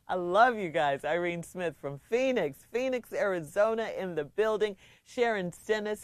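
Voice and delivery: usual speaking voice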